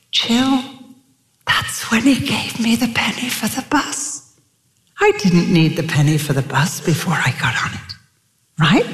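put-on a gentle, lilting Dutch-accented old lady. Corrie recalling her father's words to Jill, gentle Dutch accent. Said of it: "she had that beautiful rolling accent".

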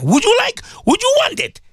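high-pitched rhetorical demand; indignant challenge. Real, not acted. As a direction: pitch leaping high on the question. Indignant challenge to listening fathers.

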